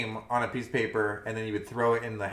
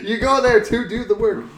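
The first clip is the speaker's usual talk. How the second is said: high, loud words through laughter; laughing hard; 12 semitones higher, 11 dB louder than usual. Real, not acted. Co-host joking about doing schoolwork, laughing hard. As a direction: crack up while getting the line out. Cracking up at own slip.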